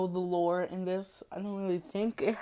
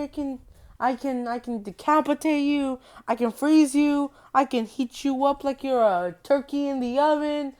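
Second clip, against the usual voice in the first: higher-pitched taunting killer voice; taunting villain; 5 semitones higher than usual. Acted. Freddy offering the girl ways to die, taunting. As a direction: toy with the victim, voice lifted.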